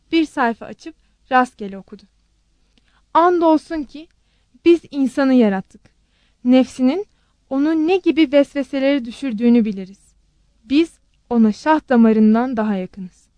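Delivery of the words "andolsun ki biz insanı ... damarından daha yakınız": loud, emphatic recitation of a holy verse; solemn scripture reading. A narrator's reading. Aunt reading a random Quran verse aloud, solemn and loud. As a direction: deliver each phrase firmly, with weighty pauses.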